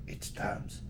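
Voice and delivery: breathy whispered it's Tomes, long O; breathy whispered correction. Retold or imitated. Retelling how he corrects people, whispered softly. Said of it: "And I said".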